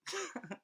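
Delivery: short, high giggle; acted laughter. Man finally getting God's joke, laughing. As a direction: break into a small, high laugh. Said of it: "He starts laughing".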